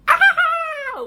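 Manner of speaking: high imitated scream; short high mock shriek. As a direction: shriek it like the panicking girl. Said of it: "what does Lexi do".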